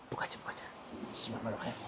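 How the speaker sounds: urgent whisper; whispered urging to open up. Real, not acted. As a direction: hiss it to your friend. Urging quietly.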